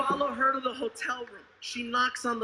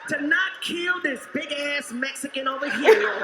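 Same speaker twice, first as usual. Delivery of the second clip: higher, pleading plea to God; mock prayer to the Lord; 6 semitones higher, slower than usual. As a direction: beg the Lord for strength. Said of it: "we really do just be starting to pray".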